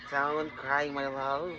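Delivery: soft, low comfort; soothing. Real, not acted. Parent calms the crying baby, soft and low.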